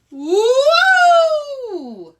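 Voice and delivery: long high loud whoop; fly through the air with a long wail; falling whoop. Acted. Arnold falling into the creek, long high whoop.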